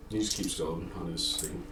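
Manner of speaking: usual speaking voice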